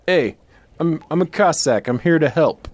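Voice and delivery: loud, high, no-effort Cossack voice; Telly Savalas as Cossack. Retold or imitated. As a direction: boom it out with no accent at all. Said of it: "clearly not having been paid enough to try to do a Russian accent".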